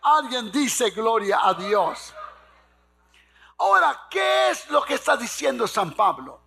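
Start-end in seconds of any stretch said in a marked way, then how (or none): none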